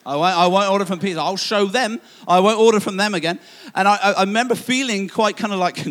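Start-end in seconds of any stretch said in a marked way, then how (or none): 0.1-3.4 defiant vow. Retold or imitated. Vowing to boycott Pizza Hut.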